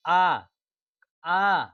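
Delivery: isolated आ, sounded from the throat; throat vowel demo. Shown as a demonstration. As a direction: voice each long आ separately. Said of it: "कंठ से निकल रही है आपकी आवाज़".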